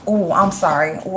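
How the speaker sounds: normal talking voice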